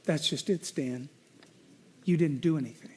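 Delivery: soft, low, tender reply; loving, gentle. Retold or imitated. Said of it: "the Lord lovingly said".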